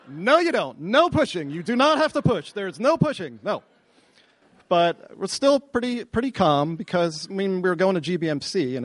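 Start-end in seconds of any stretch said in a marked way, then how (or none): 0.1-3.6 high-pitched, insistent panic; panicked refusal. Retold or imitated. Husband telling labouring wife not to push, panicked refusal. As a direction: forbid it in rising alarm, acted out.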